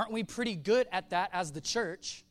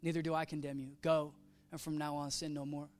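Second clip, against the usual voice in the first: gentle forgiveness; 4 semitones lower, 6 dB quieter than usual. Retold or imitated. Jesus forgives the woman.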